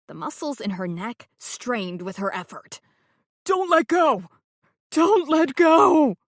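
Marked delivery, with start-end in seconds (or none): 3.5-4.3 high, loud terrified plea; dangling man screaming up; screamed plea. Acted. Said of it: "Zane screamed". 4.9-6.2 high, very loud, slow shout; dangling man at full terrified shout; full scream. Acted. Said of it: "Zane screamed".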